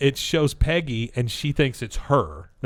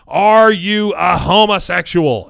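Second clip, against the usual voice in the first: slow, loud, higher-pitched accusing question; mock cross-examination; 7 semitones higher, 10 dB louder, slower than usual. Lawyer cross-examining the witness in court, theatrically accusing.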